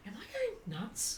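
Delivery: high, small, hushed voice; softly squeaked mock self-doubt; imitated worry. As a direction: ask it timidly, high and very soft. Acts out worried client's question.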